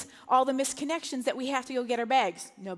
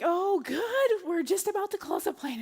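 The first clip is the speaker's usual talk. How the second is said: higher, lightly voiced agent; airline agent; 7 semitones higher than usual. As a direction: chirp it like a relieved gate agent. Airline agent greets them at counter.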